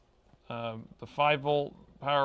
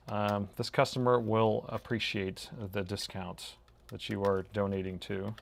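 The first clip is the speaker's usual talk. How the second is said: ordinary talk, nothing marked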